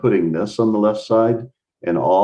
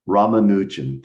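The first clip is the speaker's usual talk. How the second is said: Ramanujan in an American accent, rah-ma-NOO-jun; American accent pronunciation. Shown as a demonstration. Teacher repeats Ramanujan in his own American accent, lightly. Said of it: "my American accent pronunciation".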